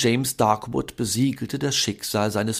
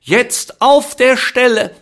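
laut, hoch und langsam befehlend; herrisch; 14 semitones higher, 12 dB louder, slower than usual. A narrator's reading. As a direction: wie eine strenge Tante rufen. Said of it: "Tante Gudruns herrische Stimme". (Tante Gudrun ruft James herrisch ins Bett)

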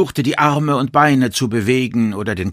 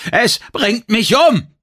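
high, loud, drawn-out cry; shouting; 12 semitones higher, 6 dB louder, slower than usual. A narrator's reading. Victim with crushed chest begging for release, shouting. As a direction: cry out slowly as if being crushed. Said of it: "schrie ich".

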